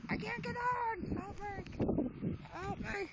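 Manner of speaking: high, panicked yelling for help; frantic high-pitched plea; panicked cry for help. Real, not acted. Panicked on spinning ride.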